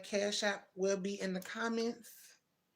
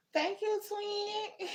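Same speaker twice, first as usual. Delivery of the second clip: high and drawn out; a sweet, high, stretched thanks; high stretched thank you to a viewer; high, slow thank you; 11 semitones higher, much slower than usual. Real, not acted.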